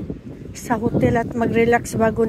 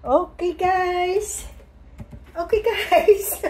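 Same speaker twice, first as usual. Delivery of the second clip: playful sing-song; 6 semitones higher, much slower than usual. Pretend. Playful slow vlog call.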